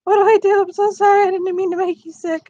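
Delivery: high panicked little cat voice; desperate cat voice. Pretend. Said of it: "He's meowing desperately".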